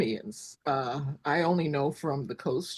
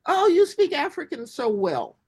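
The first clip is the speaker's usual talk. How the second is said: high, loud mock compliment; gushing flattery; 8 semitones higher, 5 dB louder than usual. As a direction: imitate well-meaning fans gushing. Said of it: "would flatter me".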